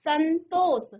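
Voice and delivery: संतोष very slow, held n before तो; pronunciation demonstration. Shown as a demonstration. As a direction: sound out संतोष for a class. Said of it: "क्या आ रहा है".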